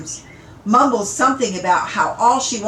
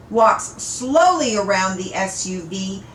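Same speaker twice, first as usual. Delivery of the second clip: plain reading voice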